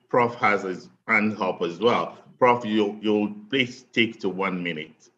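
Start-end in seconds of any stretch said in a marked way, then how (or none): none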